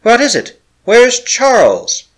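high, loud and pleading, the last words slowly; wife demanding to know where Charles is, frightened; frightened cry. A narrator's reading. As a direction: fear rising as she searches his face. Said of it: "she cried".

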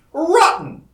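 high, loud exasperated burst; explosive one-word retort; snapped. A narrator's reading. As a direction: snap it out in frustration. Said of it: "he snapped".

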